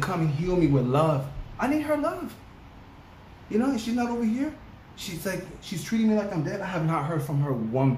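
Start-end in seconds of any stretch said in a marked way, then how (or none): 1.6-6.6 high, anguished plea; real hurt pleading. Real, not acted. Aching for the absent sister's love, hurt and pleading.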